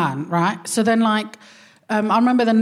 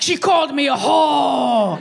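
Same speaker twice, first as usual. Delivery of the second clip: higher, slow outraged shout; outraged mum; 5 semitones higher, slower than usual. Retold or imitated. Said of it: "She's like".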